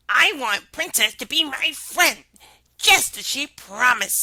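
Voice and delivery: frog in a changed timbre; odd-toned frog. Acted. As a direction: insist as the frog. Frog voiced in story.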